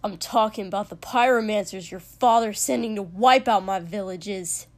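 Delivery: loud, angry outburst; near scream. A narrator's reading. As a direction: shout the accusation, running it out. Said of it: "Katsuki practically screamed".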